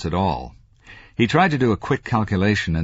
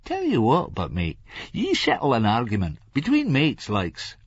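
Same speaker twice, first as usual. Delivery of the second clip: raised, playful Scots voice; mischievous Larry; 7 semitones higher than usual. A narrator's reading. Larry turns to Franklin to settle argument, mischievous. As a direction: propose it with sly mischief. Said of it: "with a glint in his eye".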